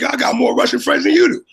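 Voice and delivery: high-pitched retold brag; retold boast, high and loud. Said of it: "I tell people, I was like".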